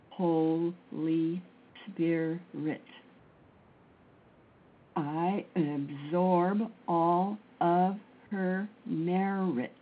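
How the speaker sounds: drawn-out, very slow prayerful chant; slow mantra. Acted. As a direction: stretch each word like a meditation.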